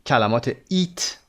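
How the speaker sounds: ordinary talk, nothing marked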